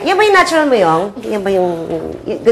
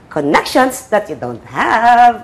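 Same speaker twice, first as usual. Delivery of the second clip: very slow, drawn-out delivery; toned-down line demo; much slower than usual. Shown as a demonstration. Director modelling the connections line for actress, slow and drawn out. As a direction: stretch it out, lingering on "have". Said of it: "O, ganun".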